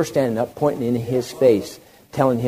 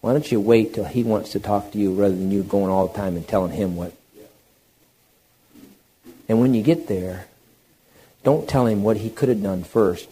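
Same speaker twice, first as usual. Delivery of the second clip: ordinary talk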